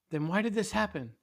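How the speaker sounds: high, loud demand for answers; anguished challenge. A narrator's reading. Guy challenges God in dream.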